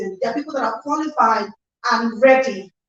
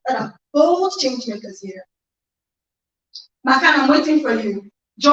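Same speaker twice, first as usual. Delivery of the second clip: ordinary talk, nothing marked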